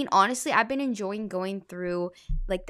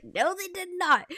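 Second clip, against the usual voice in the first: high-pitched, emphatic retold agreement; comic, mock-exasperated denial; amused denial; 9 semitones higher than usual. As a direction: pitch it right up in playful exasperation.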